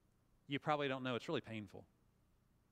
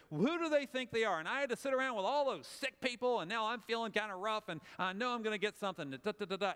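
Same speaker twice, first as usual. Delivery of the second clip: higher, louder indignant whine; whiny griping; 6 semitones higher, 3 dB louder than usual. Shown as a demonstration. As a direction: gripe to a friend, all outrage. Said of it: "you just go".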